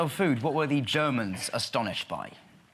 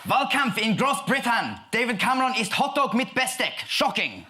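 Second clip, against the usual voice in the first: put-on loud, high German accent; 11 semitones higher, 5 dB louder than usual. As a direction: shout it in a hammy German accent. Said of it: "my best German accent now".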